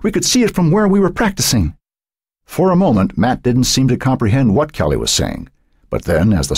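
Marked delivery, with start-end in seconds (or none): 0.0-1.7 put-on high-pitched, urgent girl's voice; gasped alarm. A narrator's reading. Cheerleader telling Matt about the fire, lightly gasped. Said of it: "Kelly gasped as she came up".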